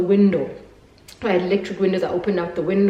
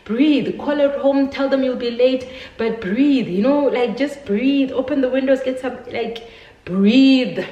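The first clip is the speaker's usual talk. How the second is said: mother's voice, higher and louder, urging her to breathe; mother on the phone urging her panicking daughter to breathe, high and louder; urgent mother; 7 semitones higher, 4 dB louder than usual. Retold or imitated. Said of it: "She's like".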